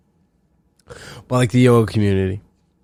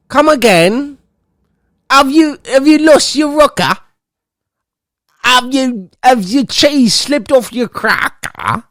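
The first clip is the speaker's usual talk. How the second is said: much higher, slow incredulous questioning; shrill disbelief; 12 semitones higher, slower than usual. Real, not acted. Reacting to the butthole-sunning post, much higher in disbelief. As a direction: sound like you can't believe your ears.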